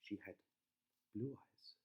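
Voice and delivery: plain reading voice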